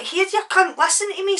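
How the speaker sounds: high, loud telling-off; confrontational. Retold or imitated. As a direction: re-enact pulling him up sharply. Acted quarrel, telling him off.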